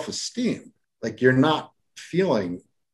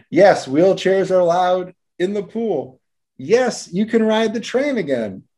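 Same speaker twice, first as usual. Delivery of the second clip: put-on high, emphatic yes to a child; excited promise; 7 semitones higher than usual. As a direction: give joyful permission, voice lifted high. Reads village's promises to children.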